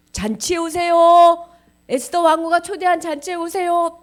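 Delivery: high, loud herald's call. Acted. Messenger calling Haman to the banquet. (크고 높게 길게 외치는 전령)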